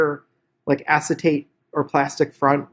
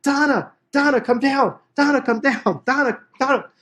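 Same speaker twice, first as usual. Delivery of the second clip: retold yelling; 9 semitones higher than usual. Husband calls wife down from the shower, high mock yelling. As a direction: imitate calling frantically upstairs, pitched way up. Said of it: "He starts yelling".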